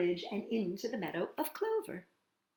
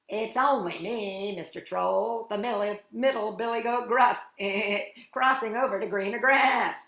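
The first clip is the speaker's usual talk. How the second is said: chuckling middle billy goat, slightly loud; lightly raised storybook goat voice; medium-sized goat voice; 3 semitones higher, 6 dB louder than usual. A narrator's reading. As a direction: answer cheekily as the middle goat, with a little bleating laugh. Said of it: "in a medium sort of voice".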